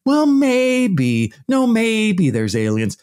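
lightly hammed-up patronising voice; mock condescending. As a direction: coax it like to a child. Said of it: "treating people like children".

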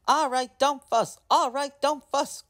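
high, whiny truck grumble; grumbling trucks. Acted. Trucks to Thomas, grumbling in high whiny voices. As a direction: complain like sulky trucks. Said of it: "grumbled the trucks".

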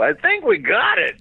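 high, emphatic movie-veteran impression; Kirk Douglas. Comic mimics veteran actor wrapping a shoot, high-pitched. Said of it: "and Kirk would go".

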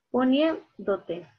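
Poniéndote slowly stretched, NIÉN leaned on; stressed syllable. Shown as a demonstration. Teacher testing the word aloud, slow stressed NIÉN in Poniéndote. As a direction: draw out Poniéndote with weight on NIÉN. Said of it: "Aquí, ¿verdad?".

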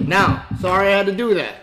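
ordinary talk, nothing marked

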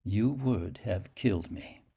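ordinary delivery, nothing marked

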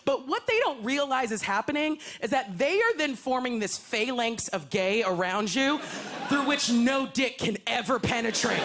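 ordinary talk, nothing marked

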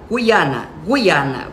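Guiana as gui-A-na, clean; correct pronunciation. Shown as a demonstration. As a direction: say Guiana with no added syllable. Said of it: "o correto é falar Guiana". (Guiana pronunciada corretamente)

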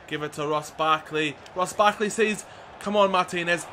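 usual speaking voice

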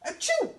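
loud, high fake sneeze; big sneeze. A narrator's reading. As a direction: blast out a cartoon achoo. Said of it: "giving a big sneeze".

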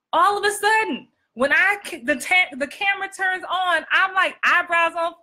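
high-pitched, overdone emphasis; exaggerated on-camera delivery. Shown as a demonstration. Coach acting out when the camera turns on, exaggerated delivery. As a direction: push the pitch up and overplay it. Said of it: "No one talks like this".

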